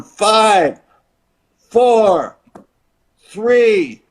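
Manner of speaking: announcer countdown. Retold or imitated. Announcer counting down to the crowd, loud and high. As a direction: call each number out to the arena. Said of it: "the announcer's going".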